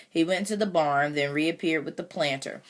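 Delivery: ordinary delivery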